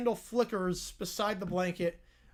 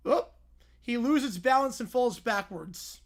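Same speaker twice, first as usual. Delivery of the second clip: ordinary talk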